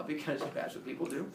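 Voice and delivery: ordinary talk, nothing marked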